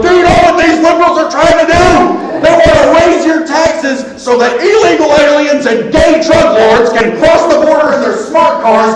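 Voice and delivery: high, loud ranting shout of a talk radio host; angry radio rant. Retold or imitated. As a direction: rant like furious conservative radio. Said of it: "those are some angry".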